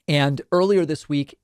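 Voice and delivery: ordinary talk, nothing marked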